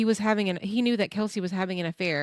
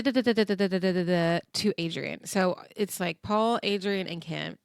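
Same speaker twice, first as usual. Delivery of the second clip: ordinary talk, nothing marked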